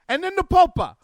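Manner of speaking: high, loud hammed-up Italian shout about the Popa; mock Italian accent. Host mocking the pope's visit in a loud, hammed-up Italian accent. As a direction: ham up a thick Italian accent, calling out. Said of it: "I don't know why I'm doing this in Italian".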